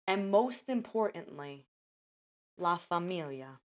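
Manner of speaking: dramatic, paused movie-trailer read; trailer voiceover. Acted. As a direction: sell it like a trailer.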